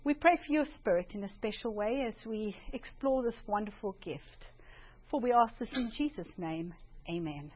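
soft, reverent opening prayer. Acted. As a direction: pray it quietly and humbly. Opening prayer before talk.

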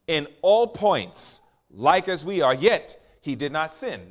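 booming pulpit delivery; full-force preaching. Acted. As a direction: preach it out to the whole church, drawing out the key lines.

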